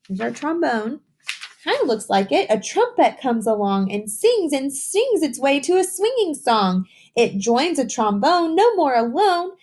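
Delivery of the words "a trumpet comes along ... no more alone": higher, singsong storybook read; reading to children. A narrator's reading. Teacher reading the trumpet page to kids. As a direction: lift the voice and let the rhyme swing. Reads picture book aloud.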